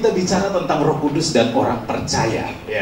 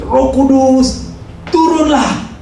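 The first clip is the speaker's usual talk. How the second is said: high, slow, drawn-out shout upward; preacher shouting again; 11 semitones higher, 4 dB louder, much slower than usual. Acted. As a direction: call the Spirit down, slow and high. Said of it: "dia teriak lagi".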